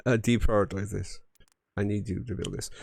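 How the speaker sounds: usual speaking voice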